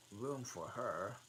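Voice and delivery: plain reading voice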